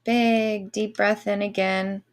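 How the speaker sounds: slow guided breathing voice; airy breathy mystical healer. Acted. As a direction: draw the breath out slowly, soothing. Leads breathing for Reiki.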